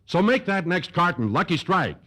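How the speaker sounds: normal talking voice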